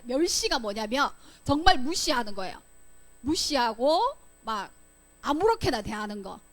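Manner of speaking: ordinary talk, nothing marked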